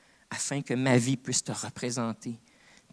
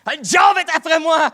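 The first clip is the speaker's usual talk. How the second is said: loud, much higher, hammed-up cry of a kid calling dad; mock panicked child; 15 dB louder than usual. Preacher jokingly calling God his daddy, hammed-up panicked cry. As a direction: wail it like a scared child, tongue in cheek.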